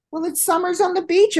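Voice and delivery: high, bright, fast announcement; excited reveal. Real, not acted. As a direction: sell the name with delight. Proud reveal of the bar.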